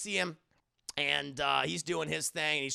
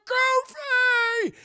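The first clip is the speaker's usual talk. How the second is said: very high, loud shouted name; loudspeaker yell; 11 dB louder than usual. Retold or imitated. Old boss yelling at Goofy over the loudspeaker. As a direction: bellow his name across the factory. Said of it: "over a loudspeaker has this old man who's like".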